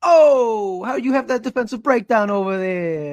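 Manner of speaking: high, nasal-free Jersey wiseguy voice; mobster impression. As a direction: lay on a New York Italian accent. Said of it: "I sound like Andrew Cuomo".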